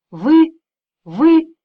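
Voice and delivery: high, loud startled gasp of words; shocked cry. Acted. Boy caught, crying out in shock. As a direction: recoil in disbelief.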